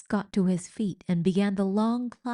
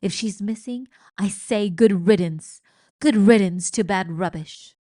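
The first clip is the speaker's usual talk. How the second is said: loud, dismissive shout; 8 dB louder than usual. A narrator's reading. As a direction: bellow good riddance. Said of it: "Luis was shouting".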